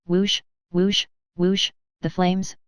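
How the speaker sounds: drawn-out whoosh like roaring flames; mock flame noise. Shown as a demonstration. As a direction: imitate the fire, slowly. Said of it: "I can do that bit with action".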